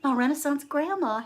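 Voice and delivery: usual speaking voice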